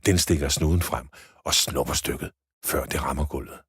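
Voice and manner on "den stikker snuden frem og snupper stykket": usual speaking voice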